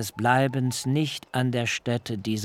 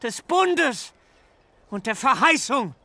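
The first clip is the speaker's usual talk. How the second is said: put-on furious old father; 7 semitones higher than usual. A narrator's reading. Jakob berates his sons for the bloodshed, high and anguished. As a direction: cry out at them in despair.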